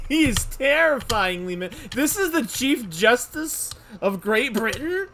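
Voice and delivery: slow, high, loud astonishment; loud disbelief. Real, not acted. Player gawking at the Chief Justice, incredulous. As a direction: draw it out, loudly incredulous.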